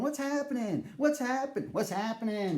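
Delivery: high, dazed repeated question; dazed bartender. Retold or imitated. Bartender serving drinks to nobody, high and dazed. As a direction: mimic a spaced-out bartender talking to nobody. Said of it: "he's still going".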